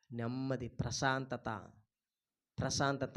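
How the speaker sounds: very low, soft voice; hushed, tranquil delivery; peaceful calm. Shown as a demonstration. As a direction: let it settle into a slow hush. Names peace after the shouting.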